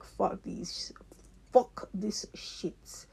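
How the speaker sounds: slow, whispered curse; mock whisper; whispered quote. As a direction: whisper it like a fed-up crowd. Imagined people quitting, whispered.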